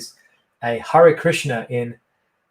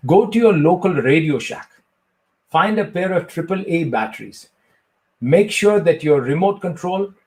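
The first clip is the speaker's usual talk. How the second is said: Indian-accented mock sage answering an interview question; Sadhguru impression. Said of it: "ask me a question as if I'm Sadhguru".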